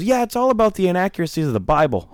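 high, earnest sales pitch; lightly hammed-up high voice; mocking earnest recruiter. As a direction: pitch it up like a keen believer. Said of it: "The guy goes".

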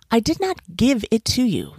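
indignant monkey, lightly yelled; mild narrated yell. A narrator's reading. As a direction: protest in a restrained storybook yell. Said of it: "yelled the monkey".

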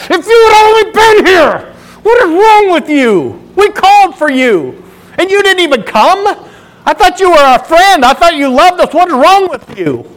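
Martha pounding his chest, crying out at Jesus; anguished, accusing friend who came too late; angry reproach. Retold or imitated. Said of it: "as she's so angry".

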